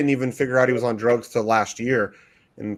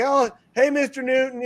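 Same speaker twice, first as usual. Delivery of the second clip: put-on surprised kid greeting; 12 semitones higher, 3 dB louder than usual. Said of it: "And he's like".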